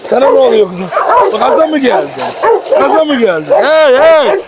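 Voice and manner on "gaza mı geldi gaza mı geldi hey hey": shouting at the dogs to settle; stopping a dog fight. Real, not acted.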